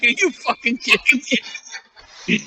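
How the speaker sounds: high, laughing disbelief; handed a disguise shirt by his friend, incredulous squeak. Retold or imitated.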